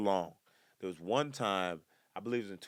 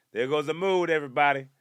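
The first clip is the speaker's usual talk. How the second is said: loud, higher mock announcement to the room; 7 semitones higher, 10 dB louder than usual. Telling viewers he ruined the mood, loud and hammed-up. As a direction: boom it out like a game-show host.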